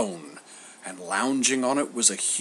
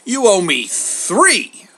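loud, slow, high correction; emphatic, stretched interruption; interrupting; 9 semitones higher, 9 dB louder, slower than usual. A narrator's reading. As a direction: cut in firmly, drawing it out. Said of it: "Homer interrupted".

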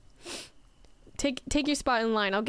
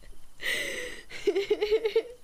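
real laugh, pitched way up; high laugh; 9 semitones higher than usual. Real, not acted. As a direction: dissolve into high giggles. Laughing at own joke.

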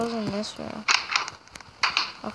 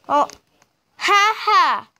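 mock laugh; 7 semitones higher, 6 dB louder than usual. Mocking laugh back.

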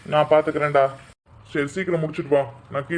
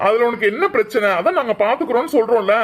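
raised, high-pitched irritation; angry rebuke; 5 semitones higher, 3 dB louder than usual. Acted. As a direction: snap at the meddler to back off. Angry rebuke in ring quarrel. (கோபத்துடன் உயர்ந்த குரலில் அதட்டி)

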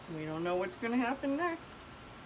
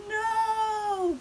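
high, loud, long no; horrified cry; 10 semitones higher, 5 dB louder than usual. Acted. Crowd watching Big Bark fall, crying out. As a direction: cry out in stretched alarm.

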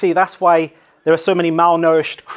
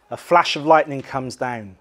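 ordinary talk

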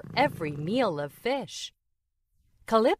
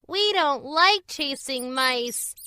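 meowing complaint; 9 semitones higher, 4 dB louder than usual. A narrator's reading. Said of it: "they meowed".

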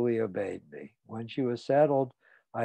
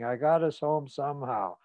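usual speaking voice

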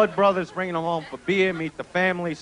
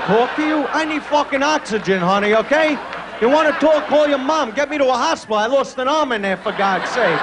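loud, higher exasperated rant; mock outrage; 4 semitones higher, 4 dB louder than usual. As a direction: yell it like you're gasping for air. Outraged reply to girlfriend.

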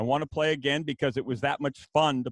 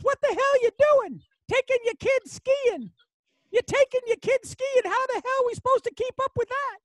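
put-on much higher gruff old-coach voice, teasing; Joe Paterno ribbing; 3 dB louder than usual. Legendary coach teasing a rival about ski trips, high gruff voice. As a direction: needle him like a cranky old coach. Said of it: "he goes".

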